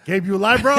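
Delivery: calling out. Retold or imitated. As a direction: call through the door, worried. Calling to teammate during firefight.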